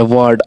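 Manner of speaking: वर्ड said as "waad" with open aa; long aa in वर्ड; "aa" vowel demo. Shown as a demonstration. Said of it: "आ का उच्चारण है".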